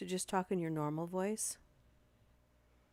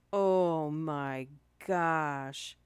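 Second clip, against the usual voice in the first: stunned; 8 dB louder, much slower than usual. Real, not acted. Reacting to the sad story.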